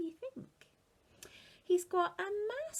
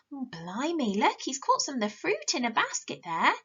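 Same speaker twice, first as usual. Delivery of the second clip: ordinary delivery, nothing marked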